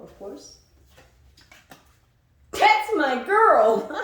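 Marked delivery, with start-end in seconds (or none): 2.5-3.9 delighted. Real, not acted. Reader cheering a card, loud and delighted. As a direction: cheer the card like a proud friend.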